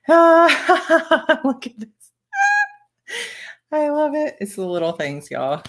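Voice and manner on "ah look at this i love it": delighted squeal. Real, not acted. Opening Hello Kitty card.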